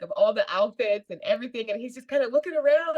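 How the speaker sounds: ordinary talk, nothing marked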